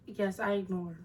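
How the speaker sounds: ordinary delivery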